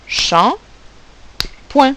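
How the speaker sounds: champ and point as two isolated beats; a long pause between champ and point; separated syllables. Shown as a demonstration. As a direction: count out champ, then point. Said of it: "il y a deux syllabes".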